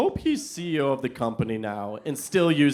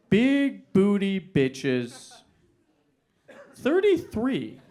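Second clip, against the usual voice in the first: each word read out slowly, the last jumping high; stunned, spelling it out to himself; slow disbelief; 4 semitones higher, much slower than usual. Retold or imitated. Reading the email address aloud in slow disbelief.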